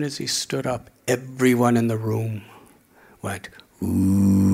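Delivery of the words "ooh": long low ooh of awe; a crowd going ooh; impressed crowd ooh. Retold or imitated. Crowd reacts to known man.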